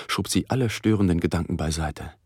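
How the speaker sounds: usual speaking voice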